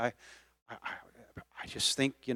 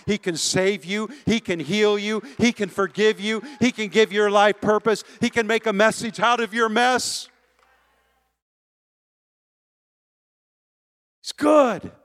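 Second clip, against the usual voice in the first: higher, louder, rising to a shout; preaching at full force; 8 semitones higher, 7 dB louder than usual. Acted. Pastor proclaiming God to the church, rising to a shout. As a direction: proclaim it, building each line.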